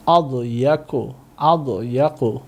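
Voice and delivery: আদয়াকু said slowly and deliberately; teacher's slow pronunciation of আদয়াকু; slow model. Shown as a demonstration. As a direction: stretch আদয়াকু out for learners to copy. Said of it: "repeated slowly".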